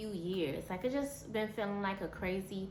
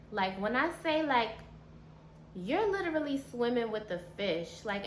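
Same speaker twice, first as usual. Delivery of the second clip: usual speaking voice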